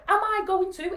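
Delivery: loud, high-pitched rhetorical question; rally the daughter with force; acted rallying challenge.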